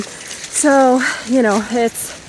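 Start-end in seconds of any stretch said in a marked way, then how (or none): none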